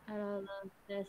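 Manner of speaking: faint, far-off mic voice; barely audible. Real, not acted. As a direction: speak barely above a murmur. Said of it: "suaranya terlalu kecil".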